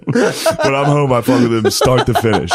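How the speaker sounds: ordinary talk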